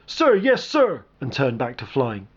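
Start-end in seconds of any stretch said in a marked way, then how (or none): 0.1-1.0 high, loud military shout; shouted "sir, yes, sir". A narrator's reading. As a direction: snap it back like a drill answer. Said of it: "Hayes gave a shout of".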